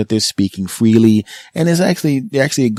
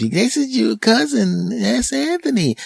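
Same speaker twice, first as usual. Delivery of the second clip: put-on very high, slow baby-talk voice; cooing introduction; slower than usual. Mom introducing the cousin to the baby, very high coo. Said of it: "baby to grown up translator".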